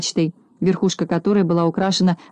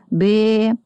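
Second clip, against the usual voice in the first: drawn-out sheep's baa; plaintive bleat; 3 semitones higher than usual. A narrator's reading. Shorn lamb turning its head, plaintive bleating. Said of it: "жалобно заблеял".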